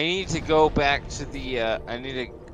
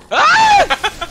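startled shout; 14 dB louder than usual. Real, not acted. Sudden game death.

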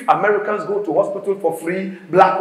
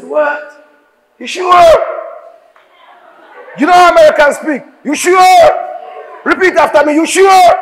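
much higher and louder, fast incredulous "You sure?"; shocked disbelief; 11 semitones higher, 5 dB louder than usual. Retold or imitated. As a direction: squeal it in mock shock. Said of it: "And he was shocked".

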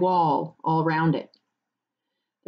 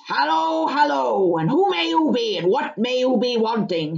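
gruff-mannered country farmer, challenging; 5 semitones higher, 3 dB louder than usual. Acted. Farmer questioning strangers at his gate, wary challenge.

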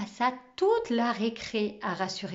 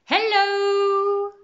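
high, loud, drawn-out hello; booming greeting; 10 semitones higher, 6 dB louder than usual. A narrator's reading. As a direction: sing it out to the whole room. Nouveau professeur fait son entrée. (salutation claironnante)

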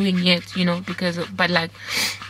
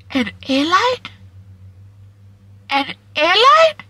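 slow, much higher, louder disbelief; disbelieving cry; 9 semitones higher, 4 dB louder than usual. Real, not acted. To viewers, marvelling that an airline called, in stunned high disbelief. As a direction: stretch it up in amazement.

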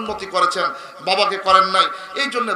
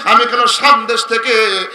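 melodic preaching; 7 dB louder than usual. Real, not acted. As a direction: chant the story, swelling into loud cries. Preacher tells story in melodic chant. (সুরেলা ওয়াজের টানা গলা)

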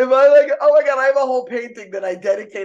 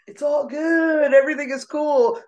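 breezy carefree self; 10 semitones higher, 4 dB louder than usual. Retold or imitated. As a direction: float it, nothing matters. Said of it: "I've gotten to such a place of".

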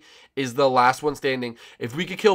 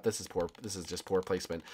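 ordinary talk, nothing marked

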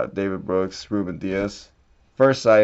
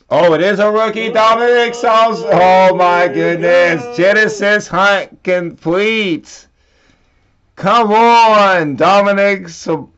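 higher, louder, fast thrilled shouts; excited yelling; 10 semitones higher, 10 dB louder, slower than usual. Real, not acted. Opening a pack and hitting a rare card, ecstatic yelling. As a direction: scream with joy at the big pull.

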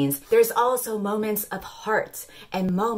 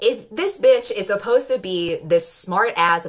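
ordinary delivery

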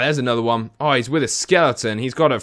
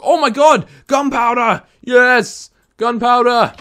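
loud, high joyful cry; ecstatic; 8 semitones higher, 5 dB louder, slower than usual. Real, not acted. Gamer finding gunpowder at last, joyful shout. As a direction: shout for joy at the drop.

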